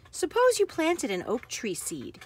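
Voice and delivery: ordinary delivery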